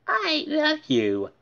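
high, slow toy voice; toy duck voice. Acted. Talking duck toy cooing to D W. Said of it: "it said".

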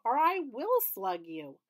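x0.7 menacing; slow threat. A narrator's reading. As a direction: spell out the threat. Elaine threatening.

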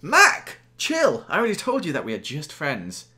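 high mock protest. Joel dismissing Mackenzie at lunch, high teasing voice. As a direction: brush her off, overplayed.

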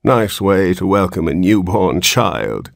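ordinary delivery, nothing marked

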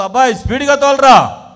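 high, very loud command to hurry; shouted urgent order. Retold or imitated. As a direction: urge a driver on at full voice. Acts woman ordering driver to hurry.

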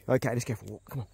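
ordinary talk

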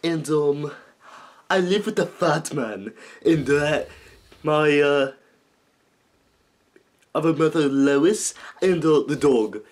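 a hint of Stewie's prim cartoon voice; Stewie Griffin voice. Shown as a demonstration. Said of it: "How to talk like Stewie Griffin".

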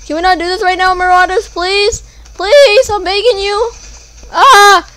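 high, loud pleading; desperate begging. Real, not acted. Gamer loudly begging the Marauders to leave him alone. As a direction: beg them to stop, voice straining.

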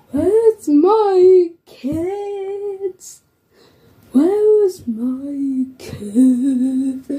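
put-on drawn-out, searching call for the kids; slow worried calling. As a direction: stretch each word like a lost parent. Pretend parent searching for kids.

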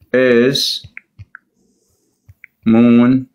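usual speaking voice

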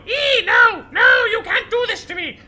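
very high, loud mock shriek; hammed-up little-girl scream. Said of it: "screaming like a little girl".

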